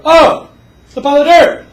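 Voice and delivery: high, loud mock excitement; hammed-up thrill at the dirt pile; excited discovery. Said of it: "They go".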